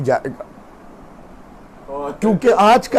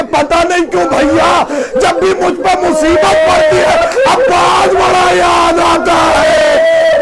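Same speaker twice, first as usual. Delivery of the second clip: wailing lament; 7 semitones higher, slower than usual. Acted. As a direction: let the recital break into a crying wail. Climactic lament with crying.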